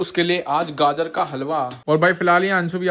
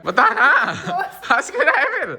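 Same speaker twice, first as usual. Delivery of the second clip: high, loud, laughing needling; laughing tease; 12 semitones higher, 5 dB louder than usual. Real, not acted. Older brother laughingly pries into who the kid is chatting with. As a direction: poke fun while laughing. Teasing brother about secret chat.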